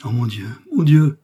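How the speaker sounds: loud, imploring exclamation; theatrical cry of appeal. A narrator's reading. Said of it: "s'écria Milady".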